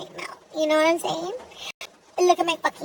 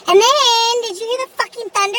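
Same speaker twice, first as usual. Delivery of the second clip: much higher and louder, alarmed; loud, higher, shaken by the thunder; alarmed outburst; 8 semitones higher, 6 dB louder than usual. Real, not acted. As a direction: blurt it out, still rattled by the thunder. Startled by the thunder.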